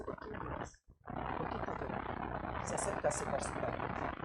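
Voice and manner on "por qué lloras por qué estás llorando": near-whispered comforting questions; hushed, gentle questioning. Acted. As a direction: ask very softly, as if consoling someone in tears. Jesus gently questioning someone weeping.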